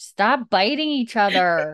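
loud, high-pitched telling-off; exasperated scolding. Real, not acted. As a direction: call it out to break up the fight. Scolding pets who are fighting.

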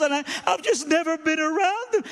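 imitated high, loud tearful gushing; emotional. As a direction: plead through it, overwrought and high. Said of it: "so sorry to be emotional".

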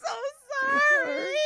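high, tearful, breaking apology; laugh-crying. Real, not acted. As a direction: squeeze it out through laughing tears.

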